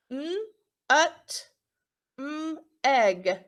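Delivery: "N, ut, m, egg" split into separate sounds with small gaps; sounding out. Shown as a demonstration. Teacher sounding out a word for her class, slow and segmented. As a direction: break "N, ut, m, egg" into its sounds, one by one. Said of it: "You can sound it out now".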